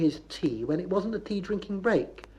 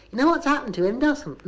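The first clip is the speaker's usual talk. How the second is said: high, louder gossiping lady; Bristol gossip voice; 9 semitones higher, 4 dB louder than usual. Shown as a demonstration. Lady teases friend with news of a neighbour, high gossipy demonstration. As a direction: lead her on with juicy news. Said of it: "that's the kind of voice I shall use".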